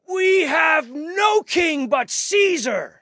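very high, slow, loud shout; priests shouting. A narrator's reading. Chief priests answering Pilate, slow full shout. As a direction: bellow each word in defiance. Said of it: "the chief priests shouted".

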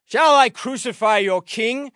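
loud, high, rising question; challenging question. A narrator's reading. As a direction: throw the question at the mob.

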